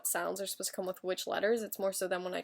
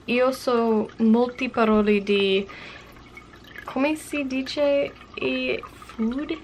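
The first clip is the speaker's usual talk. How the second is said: reading a journal entry in Italian; 3 semitones higher, 7 dB louder than usual. Acted. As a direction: read your Italian diary aloud, hesitant and careful. Reads Italian journal entry aloud.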